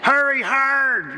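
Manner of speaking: high, drawn-out holler; curling shout. Shown as a demonstration. Curler yelling at teammates to hurry, demonstrated shout. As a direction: shout it like a curler calling down the ice. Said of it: "you're supposed to shout at other people".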